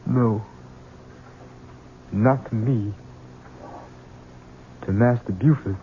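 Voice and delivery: low menace. Acted. Son vows revenge quietly.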